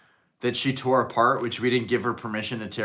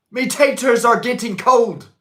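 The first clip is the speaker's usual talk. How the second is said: high, loud hammed-up cockney; exaggerated British voice; mock British accent; 11 semitones higher, 7 dB louder than usual. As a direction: put on a silly British accent. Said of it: "I think that's more Irish".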